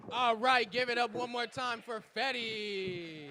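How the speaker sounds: booming all right to rally the room; loud hype call. Real, not acted. Host shouting to fire up the crowd, loud.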